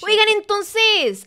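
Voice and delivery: loud, high, slow call to viewers. Real, not acted. Calling viewers to attention.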